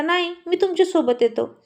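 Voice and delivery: put-on a little boy insisting on coming along; small boy voice. Small boy Rana insists on going with Rohit, boyish voice.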